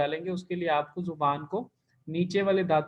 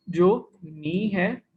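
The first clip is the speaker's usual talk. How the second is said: drawn-out syllable; 3 dB louder, much slower than usual. Shown as a demonstration. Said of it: "उसमें".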